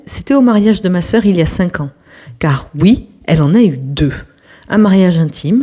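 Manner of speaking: usual speaking voice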